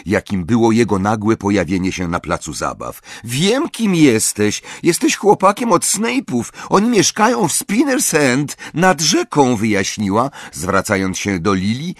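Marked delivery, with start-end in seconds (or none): 3.2-9.6 put-on high, haughty child voice; scornful high-pitched sneer; disparaging. A narrator's reading. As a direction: look down on the address, voice pitched well up. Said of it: "po tonie jej głosu można było poznać".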